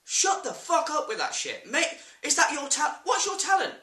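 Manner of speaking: high, heated telling-off; indignant outburst; exasperated rant. Real, not acted. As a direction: tear into them, pitch climbing. Ranting at gimmicky British vloggers.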